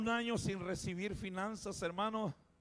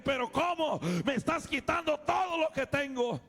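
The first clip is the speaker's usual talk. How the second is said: desperate protest; 7 semitones higher, 6 dB louder than usual. Retold or imitated. Protesting to God.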